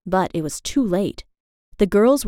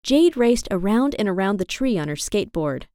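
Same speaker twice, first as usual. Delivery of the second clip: plain reading voice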